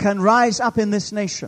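high, loud proclamation; fervent preacher at full voice; full-force preaching. Real, not acted. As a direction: drive it out to the whole congregation.

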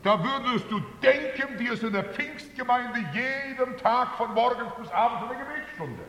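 high-pitched, insistent pulpit delivery; animated preaching. Real, not acted. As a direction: exclaim it to the congregation, pitch held high. Preaches with heightened force. (mit hoher Stimme eifrig gepredigt)

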